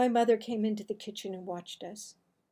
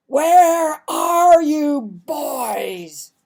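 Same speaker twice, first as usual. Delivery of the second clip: slow, booming father's call, much louder; a father bellowing through the whole house; 3 semitones higher, 15 dB louder, much slower than usual. Acted. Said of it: "His voice went through every crack and corner".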